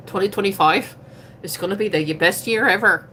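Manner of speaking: put-on playful Irish accent; accidental Irish accent. Streamer declaring her year to come, in Irish accent. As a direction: slip into a jokey Irish lilt. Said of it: "where that accent came from".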